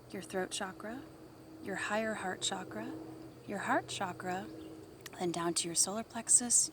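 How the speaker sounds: hushed guided chakra walk; soft meditation voice through the chakras; soft spa whisper. Acted.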